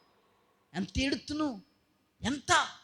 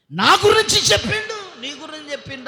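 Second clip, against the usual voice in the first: mock complaint; 8 semitones higher, 5 dB louder than usual. Mimics complaining churchgoers.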